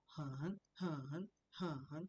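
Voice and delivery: "很" dipping down and then rising; full third tone. Shown as a demonstration. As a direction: swoop down and back up on every "很". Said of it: "down, up".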